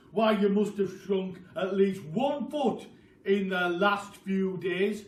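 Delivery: loud, slow gloating taunt; taunting Mr Twit. Acted. Husband taunting his wife that she is shrinking, loud and slow. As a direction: drag out every word to scare her.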